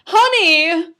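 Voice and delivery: high, very loud drawn-out call; mock calling out. Vlogger pretending to summon a wife, hollering. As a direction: holler for a spouse in another room.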